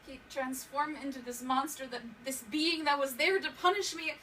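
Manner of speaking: loud, high, frantic retelling; panicked begging at full voice; desperate pleading. Acted. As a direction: relive the beating, voice rising into cries. Re-enacts beating, begging for mercy.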